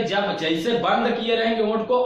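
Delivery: ordinary talk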